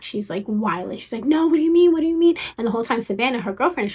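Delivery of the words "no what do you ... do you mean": much higher, louder panicked repeat; retold panic. Friend panicking at dad's news about the dress, imitated panic. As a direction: imitate her losing it over the dress. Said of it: "having an anxiety attack".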